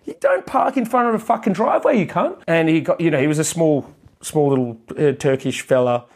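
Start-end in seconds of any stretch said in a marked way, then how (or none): 0.0-2.4 high-pitched yell at the driver; retold yell. Yelling at the DHL driver over the blocked driveway, retold yell. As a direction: shout him down from the doorway.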